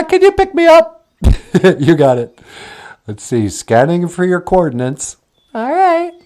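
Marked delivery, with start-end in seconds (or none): 1.8-5.2 much lower, odd voiced character; low puppet voice. Puppet answering Natasha, deep voiced character.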